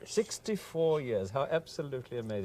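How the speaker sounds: upper-class English Hooray Harry, politely amazed; Hooray Harry accent. Acted. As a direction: marvel like an Oxford toff with an English accent. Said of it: "One of the Hooray Harrys".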